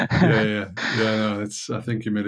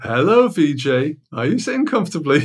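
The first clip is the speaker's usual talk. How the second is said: put-on children's TV presenter; 4 semitones higher, 4 dB louder than usual. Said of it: "it's going to be like the Sesame Street sort of thing".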